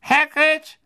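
put-on high, thin voice of an old official; reedy old committee man, impatient; frail old man wanting it over. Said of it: "die dünne Stimme des alten Ausschussmitglieds".

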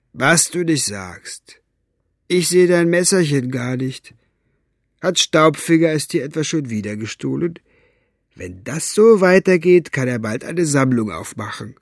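unimpressed, amused jeering; lightly voiced mocking henchman. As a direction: needle him about his loss. Flachnase mocks Basta.

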